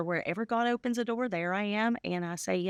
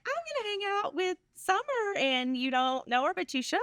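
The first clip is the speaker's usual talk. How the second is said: high, bright mock-excited quote; chirpy self-quote, pitched well up; excited invitation; 8 semitones higher than usual. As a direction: imitate yourself pitching the show to friends, higher. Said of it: "I'm like".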